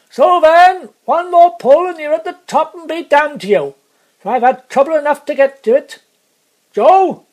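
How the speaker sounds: loud, high-pitched shouting at the team; gruff exasperated coachman. Acted. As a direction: holler it from the coach box, fed up. Coachman shouts to horses, then guard.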